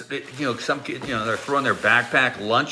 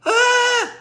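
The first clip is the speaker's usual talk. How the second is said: short, high, loud "ah"; high yelp of pretend disgust; mock shriek; 6 dB louder than usual. Teller acting grossed out at contact, high mock shriek.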